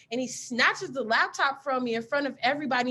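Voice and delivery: ordinary delivery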